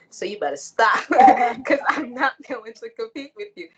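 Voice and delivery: laughing, half-joking threat to a rival stud; laughing warning. Retold or imitated. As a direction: warn her off while laughing.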